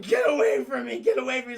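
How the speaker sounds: a loud hammed-up snarl at Spider-Man; mock shout. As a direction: yell to fend off Spider-Man. Morbius cornered, shouting.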